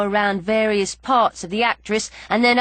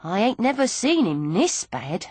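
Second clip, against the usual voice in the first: plain reading voice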